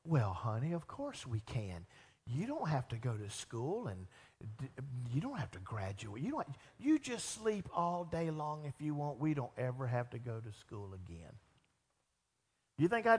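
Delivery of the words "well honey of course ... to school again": mock sweet father. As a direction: lower and softer, syrupy indulgent dad. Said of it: "like a good father".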